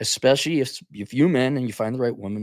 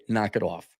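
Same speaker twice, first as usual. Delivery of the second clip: ordinary talk, nothing marked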